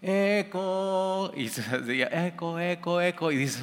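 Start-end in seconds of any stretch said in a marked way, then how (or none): 0.0-1.3 put-on child calling out. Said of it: "Y ahí estás como niño chiquito". 2.1-3.3 last word echo. Retold or imitated. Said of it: "donde hay eco".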